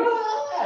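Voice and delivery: small, high-pitched cry of someone falling; mock falling scream. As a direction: token high "ah" of a plunge. Villain's cry falling from train.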